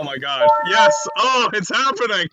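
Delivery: loud, high, gleeful cry; excited shout. Real, not acted. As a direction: shout it out with glee. Gleeful at the opponent's play.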